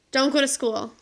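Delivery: usual speaking voice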